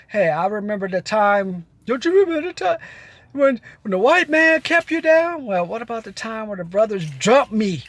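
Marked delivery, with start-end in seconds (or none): 1.9-5.5 high put-on voice of a pandering politician; mocking campaign voice. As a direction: sweet-talk voters in a mocking high pitch.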